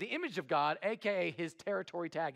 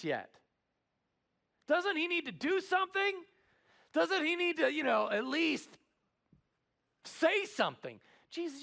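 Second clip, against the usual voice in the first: indignant objection; 8 semitones higher than usual. Retold or imitated. Voicing the religious objectors.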